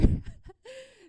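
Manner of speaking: high laugh bursting out loud; laughing aloud at her own roller coaster story; loud burst of laughter. Real, not acted.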